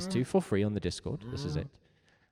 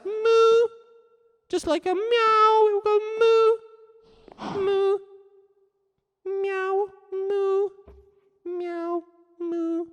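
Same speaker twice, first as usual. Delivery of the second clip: much higher, squeaked moo; tiny squeaky moo; 8 dB louder, slower than usual. Shown as a demonstration. Teacher demonstrating a tiny cow's moo for chat. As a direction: moo like a kitten-sized cow.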